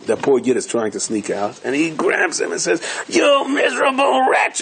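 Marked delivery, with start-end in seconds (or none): 3.1-4.6 much higher, slow shouted insult; furious shout. Retold or imitated. Innkeeper shouting at the poor Yid, high and furious. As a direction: spit the insult at him.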